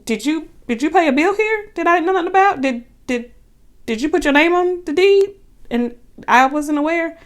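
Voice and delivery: sarcastic. Retold or imitated. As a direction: needle him with fake politeness. Sarcastic questions to boyfriend.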